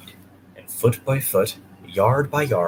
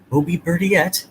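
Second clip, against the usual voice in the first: higher, a little louder sing-song echo; lightly voiced coaches echoing; 7 semitones higher, 5 dB louder than usual. A narrator's reading. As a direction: repeat it back like two eager coaches. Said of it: "echoed Annie and Clarabelle".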